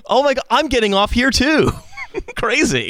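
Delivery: high-pitched overeager stranger; mock excitement. Passenger chats up a stranger on transit, mock-excited. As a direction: gush at the coincidence, high and giggly. Joins the acted chat between strangers.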